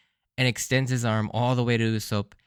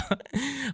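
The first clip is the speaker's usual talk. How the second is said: high laugh; 14 semitones higher, 5 dB quieter than usual. Real, not acted. Laughing at own impression.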